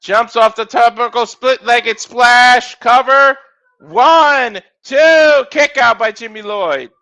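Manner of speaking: rapid ringside calls rising on big moves; live play-by-play. Acted. Commentator calling a wrestling match to viewers, energetic play-by-play. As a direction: call the match as it happens.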